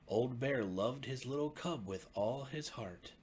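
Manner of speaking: plain reading voice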